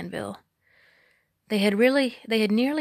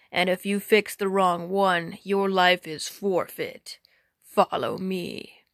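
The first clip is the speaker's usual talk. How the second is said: slow menacing giant; slower than usual. Acted. Giant threatens Robin.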